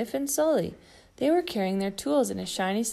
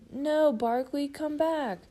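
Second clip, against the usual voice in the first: mild mock yell; 7 semitones higher, slower than usual. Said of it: "Big Bird yelled".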